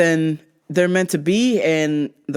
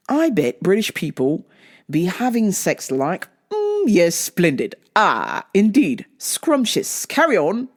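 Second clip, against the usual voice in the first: hammed-up British politeness, pitch swinging about; mock British accent. Reading a meme about British people in bed, mock British accent. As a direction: read it like a prim British caricature. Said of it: "have to read it with a British accent".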